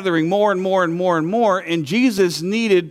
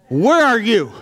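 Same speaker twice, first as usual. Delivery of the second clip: loud, high desperate cry; yelling at God; 6 semitones higher, 5 dB louder than usual. Retold or imitated. Crying out to God alone at night, loud and desperate. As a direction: call out, demanding an answer. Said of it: "I'm yelling at God".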